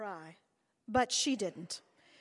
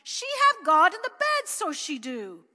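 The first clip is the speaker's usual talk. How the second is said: put-on loud, high child's cry; a small boy crying out the secret; high, loud, slow child's cry; 7 semitones higher, 10 dB louder, slower than usual. A narrator's reading. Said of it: "he cried".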